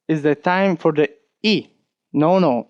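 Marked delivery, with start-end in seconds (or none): none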